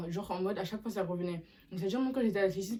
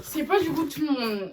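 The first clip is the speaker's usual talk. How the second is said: high, loud burst of insistence; loud and high, daring anyone to disagree; emphatic exclaim; 8 semitones higher, 8 dB louder than usual. Real, not acted. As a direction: belt it out, defiant. Strong reaction to her shoes.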